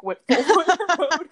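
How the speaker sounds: laughing through the words. Real, not acted. Laughing while telling friend about her lawyer mom. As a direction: giggle as you say it.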